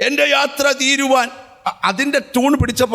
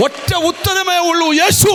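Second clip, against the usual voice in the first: rising preacher's shout on Yeshu; preaching climax; 6 semitones higher than usual. Acted. Preacher climaxes on Jesus' name. (ഉച്ചത്തിൽ പ്രസംഗ ഘോഷം)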